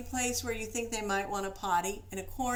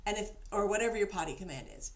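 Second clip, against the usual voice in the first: ordinary delivery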